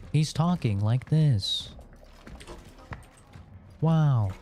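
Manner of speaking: lightly put-on streamer voice with drawn-out endings; slow, emphatic delivery landing on each phrase end; Pirate Software impression. Streamer showing viewers how another streamer talks, lightly imitated. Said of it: "there's clips of pirate software talking like this".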